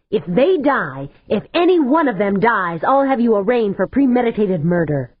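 heated warning of murder charges. A narrator's reading. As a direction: press hard, rising anger. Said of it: "Stavanger said heatedly".

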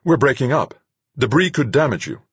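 urgent, high-pitched warning; startled cry. A narrator's reading. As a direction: warn loudly in alarm. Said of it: "Duchamp cried, startled".

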